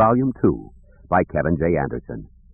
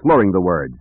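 plain reading voice

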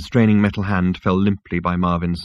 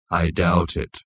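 gloomy robot; 3 semitones lower, slower than usual. Acted. Marvin's gloomy voice.

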